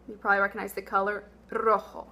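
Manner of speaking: normal talking voice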